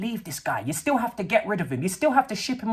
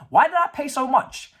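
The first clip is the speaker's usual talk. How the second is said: higher, louder mock whine; higher, louder mock disbelief; mock owner complaint; 6 semitones higher, 4 dB louder than usual. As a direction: voice a baffled owner. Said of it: "That's what I'm watching though".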